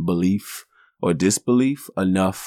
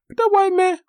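much higher put-on little boy voice; scared kid voice; 4 dB louder than usual. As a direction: play the startled young boy. Kid protests to dad.

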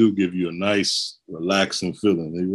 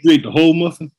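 disbelief; 7 semitones higher, 6 dB louder, much faster than usual. Real, not acted. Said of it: "incredulous co-host".

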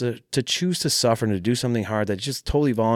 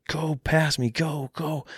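yelling; 7 semitones higher, 3 dB quieter, slower than usual. Retold or imitated. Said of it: "I'm screaming at him".

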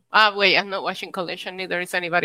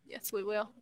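much softer, trailing echo repeat; fading echo; 15 dB quieter than usual. Acted. Co-host echoing her own agreement, fading away. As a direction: let it fade like an echo. Echoed affirmation.

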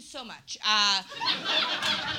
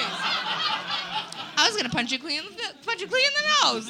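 high, loud comic threat; hammed-up would-be puncher; mock threat; 9 semitones higher, 5 dB louder, slower than usual. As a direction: build louder and higher to the end. Voicing person threatening a punch.

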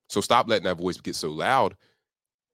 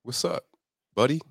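casual greeting to inner voice; 5 semitones higher than usual. Pretend. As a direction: greet your doubts like an old pal. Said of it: "Talk to that voice".

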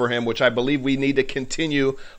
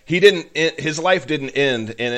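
normal talking voice

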